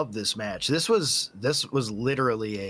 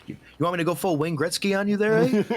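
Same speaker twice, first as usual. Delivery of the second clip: mock Canadian; 7 semitones higher, 3 dB louder than usual.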